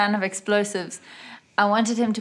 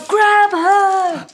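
high, loud acted command; shouted in character, pitched way up; yell; 10 semitones higher, 8 dB louder than usual. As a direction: yell it out as an order. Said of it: "Hitsuji also yell".

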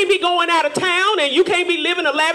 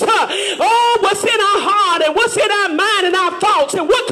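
loud, higher whooping sermon cadence with "uh, oh"; preaching at full force; 4 semitones higher, 5 dB louder than usual. Acted. Preacher to the congregation about hard praying, whooping. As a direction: build the congregation up in a chanted preach.

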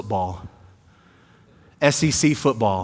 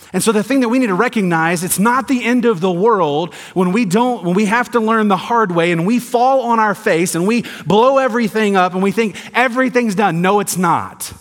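fiery preaching; 6 semitones higher, 4 dB louder than usual. Acted. Preaching builds to full force.

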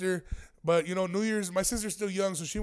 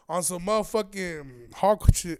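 slow, drawn-out build; slow punchline; slower than usual. Real, not acted. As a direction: stretch it out for the joke. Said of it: "repeated phrase, first take".

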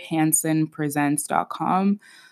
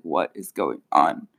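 much lower, put-on baffled voice; bewildered inner voice; 13 semitones lower than usual. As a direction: drop deep in mock bewilderment. Acted bewildered reaction to cold.

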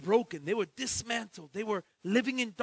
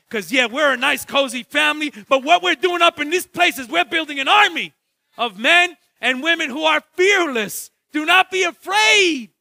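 loud, high preacher's call; full-force preaching; 7 semitones higher, 13 dB louder than usual. Acted. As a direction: preach it out to the whole church.

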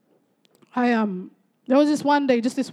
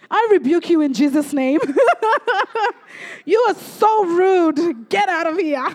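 mock indignant rebuke; 5 semitones higher than usual. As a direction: play up an outraged mother telling off her child. Retold angry mother's rebuke.

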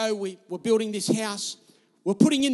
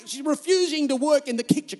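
normal talking voice

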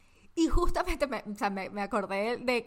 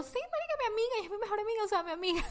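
much higher, fast, proudly insisting; gushing excitement; 10 semitones higher, faster than usual. Retold or imitated. Telling her cousin proudly who her best friend is, gushing. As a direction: gush about your best friend. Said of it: "y yo".

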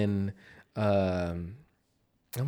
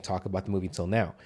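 ordinary talk, nothing marked

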